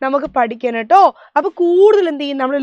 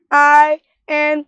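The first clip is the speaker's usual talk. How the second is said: slow spelling; much slower than usual. Shown as a demonstration. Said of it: "എം ഒ യു എൻ".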